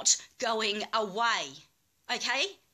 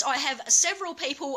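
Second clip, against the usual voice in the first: normal talking voice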